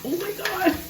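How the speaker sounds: high, breathy oh my God; surprised. Real, not acted. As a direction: gasp it out high. Reacting to food.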